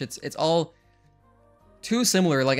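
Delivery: normal talking voice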